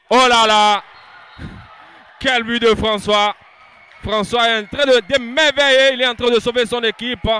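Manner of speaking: loud, fast goal call; excited sports commentary. Real, not acted. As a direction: shout the goal with rising excitement. Goal commentary building to shouts.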